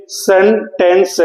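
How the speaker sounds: Sentence slowly broken into separate sound chunks; split into syllables. Shown as a demonstration. Teacher dividing a word into parts for students, syllable by syllable. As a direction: say sentence in parts, with short gaps. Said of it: "तीन भागों में".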